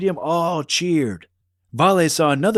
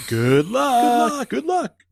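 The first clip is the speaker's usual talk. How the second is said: high excited cheering calls; yelling; 10 semitones higher, slower than usual. Acted. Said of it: "yelled the Marlowe explorers".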